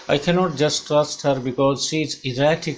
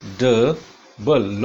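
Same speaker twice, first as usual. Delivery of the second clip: लॉ, ड, बल with a clear pause after each; teacher sounding out "लॉ, ड, बल" one beat at a time; three separate syllables; much slower than usual. Shown as a demonstration. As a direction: break laudable into "लॉ... ड... बल". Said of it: "three syllables इसमें है".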